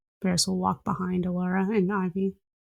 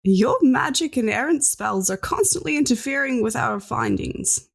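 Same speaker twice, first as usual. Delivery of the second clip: put-on huffy old scientist; 6 semitones higher than usual. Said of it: "he continues on".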